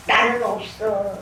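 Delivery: put-on whine of having nothing; grumbling imitation. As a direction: imitate someone moaning with a scowl. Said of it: "인상을 찌그리며 그런다고".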